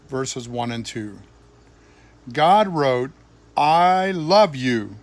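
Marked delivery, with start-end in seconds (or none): none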